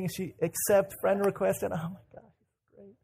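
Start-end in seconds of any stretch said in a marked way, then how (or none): 1.7-2.9 hushed, higher breathless squeal; mock hyperventilating. Girl who just accepted his friend request, mock hyperventilating. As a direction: gush with almost no voice left. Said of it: "she's, like, hyperventilating".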